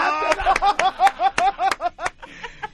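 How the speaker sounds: loud laughter, rising in pitch; real big laughter; long loud laugh. Real, not acted. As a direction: crack up at the confession. Laughing at own confession.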